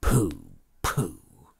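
put-on low dismissive pooh-pooh in an old miser's voice; old Scrooge scoffing low at his own fear. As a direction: brush off the fright with a low grumble. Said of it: "and so he said".

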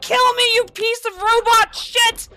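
high, loud yelling at the game; furious shout. Real, not acted. Gamer yelling at robot enemy, furious shout. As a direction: scream abuse at the robot. Rage at game killing him.

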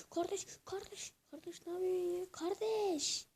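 high-pitched pleading with a teammate; high, agitated appeal; exasperated protest. Real, not acted. Protesting at teammates mid-game.

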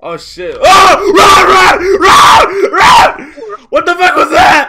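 terrified yell to run; panicked screaming. Real, not acted. Chased by the ghost, screaming.

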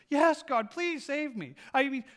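man dangling from a root, pleading loudly; desperate prayer. Acted. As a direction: beg for rescue while hanging off a cliff. Man begging God in fable.

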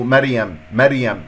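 مريم clipped to meryem; wrong light ra. Shown as a demonstration. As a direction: say مريم with a thin r and e vowels. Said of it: "هذا خطأ".

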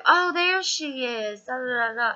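high sing-song patronizing staff voice; overly sweet, talking down as to a child; mocking fussy staff. Mocking the staff who fussed over her in the lobby, sing-song. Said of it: "And they're like".

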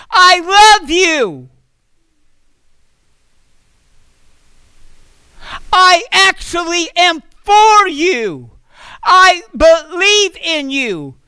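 declaring love with slow, ringing conviction; emphatic affirmation. Acted. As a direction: proclaim every word of love like a promise. Wife's affirmation proclaimed.

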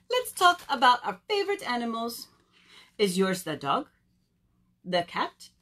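questions swung upward in mock French accent; silly French accent, teasing out each question; terrible French accent. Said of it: "I do a very terrible accent".

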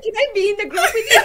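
high, loud, fast eager ask; eager laughing plea. Retold or imitated. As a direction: beg to join, laughing. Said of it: "And I was like".

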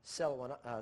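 usual speaking voice

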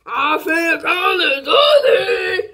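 slow stretched-out shout; drawn-out yell. Pretend. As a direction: drag it out and holler. Repeats announcement, drawn out.

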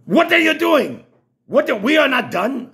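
alarmed shout. Retold or imitated. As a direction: panic as he opens the door. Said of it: "And I hit the button to open the door".

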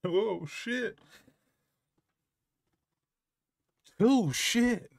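shocked, high-pitched "oh shit"; stunned surprise. Real, not acted. Reactor, stunned by the video's ending, in high-pitched surprise. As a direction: blurt it out in disbelief.

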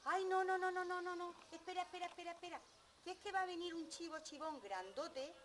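high, rapid-fire panicky begging; pleading middle goat. Acted. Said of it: "el chivo chivón mediano le dijo".

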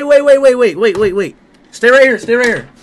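ordinary talk, nothing marked

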